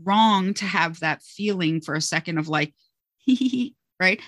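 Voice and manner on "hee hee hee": high mock giggle; a little pretend snicker, pitched up; imitated giggle. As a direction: imitate kids starting to giggle. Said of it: "smile or begin to laugh".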